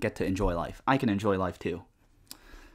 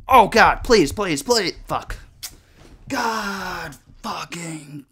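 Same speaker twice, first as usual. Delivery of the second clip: loud, high, panicked begging breaking into a cry; real panic, voice cracking; desperate pleading; 7 semitones higher, 6 dB louder, slower than usual. Real, not acted. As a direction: plead with the game not to let you die. Dying in game, desperate.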